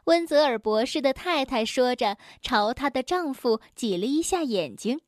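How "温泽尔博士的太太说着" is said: ordinary delivery, nothing marked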